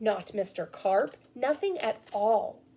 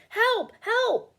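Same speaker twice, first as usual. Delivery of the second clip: call for help; 10 semitones higher, 4 dB louder than usual. Acted. Policeman radios firemen.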